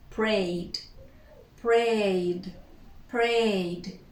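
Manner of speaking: "prayed" as one syllable, vowel into D; slow, careful "prayed" with a final D; only the D. Shown as a demonstration. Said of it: "we pronounce only the D".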